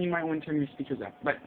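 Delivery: normal talking voice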